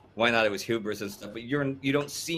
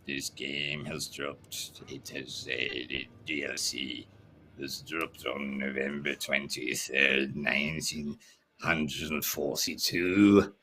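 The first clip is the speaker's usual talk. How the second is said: slow, low, soft statesman impression; Churchill; 9 semitones lower, 3 dB quieter, slower than usual. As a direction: drag the words out low and slow like a wartime orator. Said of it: "somebody asked if I could do the Churchill impression".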